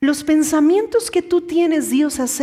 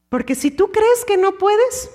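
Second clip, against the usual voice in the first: pitched higher, rising doubtful tone; raised questioning; 6 semitones higher than usual. Shown as a demonstration. Preacher voicing the doubter, higher and rising. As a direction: lift the voice as if doubting.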